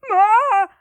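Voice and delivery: sudden high startled yelp; gnome yelp. Acted. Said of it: "and he goes".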